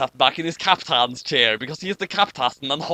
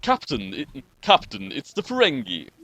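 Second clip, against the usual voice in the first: Sulu voice; 6 semitones lower, slower than usual. Acted. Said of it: "that was just an attempt to get into character".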